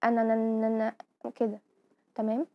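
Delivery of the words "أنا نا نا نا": teacher showing a stammer on أنا; stammer demo. Shown as a demonstration. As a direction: repeat the syllable نا in quick stuttered bursts: أنا، نا، نا، نا. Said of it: "كده مثلًا".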